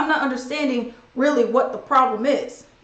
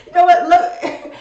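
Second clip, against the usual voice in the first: laughing; 5 semitones higher, 7 dB louder, slower than usual. Real, not acted. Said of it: "laughs while speaking".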